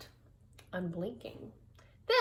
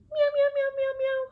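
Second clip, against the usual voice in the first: squeaky high mock meowing; high cat meows; 7 dB louder, faster than usual. To the viewers, playing the cat: high mock meows. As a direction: meow high like a little kitten.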